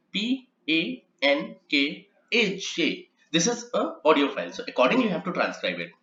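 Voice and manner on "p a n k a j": letters spelled out slowly; slow spelling. Shown as a demonstration. Trainer spelling a name letter by letter, slowly. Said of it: "This only applies when I say".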